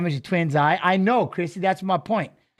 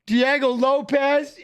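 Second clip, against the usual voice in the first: hyped name call; 5 semitones higher, slower than usual. Real, not acted. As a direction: call the name out like a ring announcer. Hyped reading of fight card.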